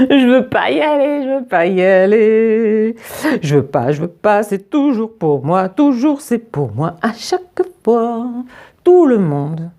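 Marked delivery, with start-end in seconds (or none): 0.1-8.3 put-on bawling child. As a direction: wail like a child in a meltdown. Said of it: "Louis se jette au sol en pleurant à gros bouillons".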